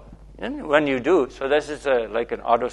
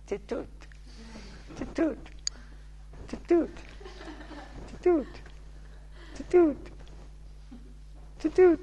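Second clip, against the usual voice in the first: high, climbing playful peekaboo calls; rising sing-song titut for a one-year-old; peekaboo game; 5 dB quieter than usual. Shown as a demonstration. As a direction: play peekaboo with a toddler. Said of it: "what do you say in, Swedish?".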